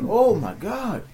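high, drawn-out mock amazement; imitated Caribbean man. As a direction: stretch it out high as his astonished reaction. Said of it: "He's like".

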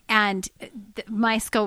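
ordinary talk, nothing marked

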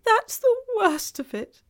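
high, loud groan of misery. A narrator's reading. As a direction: groan it out in despair. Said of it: "with a deep groan".

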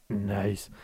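low, soft catchphrase impression; Asketil. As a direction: drop low and soft, imitating a colleague's catchphrase. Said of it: "Asketil le fait mieux que moi".

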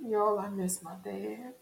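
soft, slow and choked up, close to tears; barely holding it together, quietly; a hushed, grieving near-sob; tearful. Real, not acted. Grieving her late father, breaking down.

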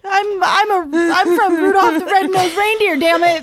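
a hint of a cute cartoon reindeer. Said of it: "It's my reindeer voice".

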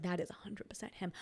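hushed whisper, sure of it; whispered realization. Retold or imitated. Realizing under her breath that the doctor is her ex, whispered. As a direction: whisper it to yourself in recognition. Recognizing his voice, inner thought.

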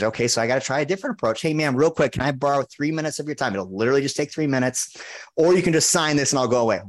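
fast, high, chirpy new pitch; new sales pitch. Retold or imitated. Switching tactics on a shopper, fast and high. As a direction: try a brisker, brighter angle. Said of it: "I changed my pitch every time".

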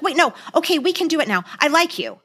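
a touch of flustered, urgent pleading; acted panic. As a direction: imitate her scrambling to take it back. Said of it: "she panics and she's like".

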